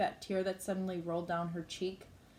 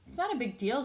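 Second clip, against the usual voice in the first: mildly defensive, pitched up; 6 semitones higher, 3 dB louder than usual. A narrator's reading. Olivia answers Justin about her old friend, defensively. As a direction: brush it off a bit defensively. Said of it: "she answers defensively".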